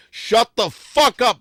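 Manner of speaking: high, loud, slow bellow; angry shout. Acted. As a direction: yell her into silence. Pimp yelling at his girl.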